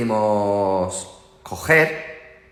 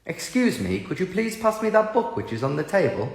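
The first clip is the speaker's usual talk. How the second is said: polite English voice; 5 semitones higher, 3 dB quieter than usual. Retold or imitated. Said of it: "en Inglaterra".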